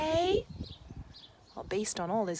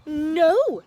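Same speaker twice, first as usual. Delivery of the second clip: much louder stubborn "No"; loud refusal; 6 semitones higher, 13 dB louder than usual. Acted. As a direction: bark it at the baker. Ned refuses baker.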